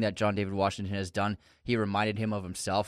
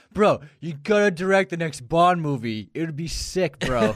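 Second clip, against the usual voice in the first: put-on loud, high drunk bro voice; hammed-up drunk party bro; frat bro; 7 semitones higher, 7 dB louder than usual. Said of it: "I can only imagine he was like".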